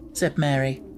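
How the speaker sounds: ordinary delivery, nothing marked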